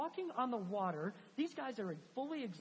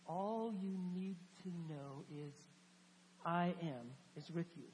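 tender reassurance; 4 semitones lower than usual. Acted. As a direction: speak it gently to the struggling. Jesus comforting in struggle.

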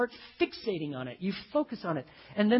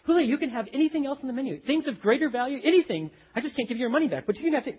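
higher, hurried placating; retold pleading; 7 semitones higher, 4 dB louder, faster than usual. Employee offering other menu items to angry customer, retold pleading. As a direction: placate her in a raised pitch.

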